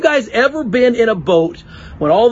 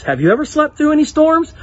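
normal talking voice

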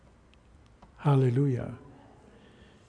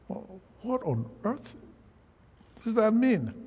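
high-pitched puzzled question, starting soft; baffled; 7 semitones higher, 3 dB quieter than usual. Acted. Preacher puzzling over a verse for the congregation, baffled. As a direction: wonder aloud, voice jumping up in bafflement.

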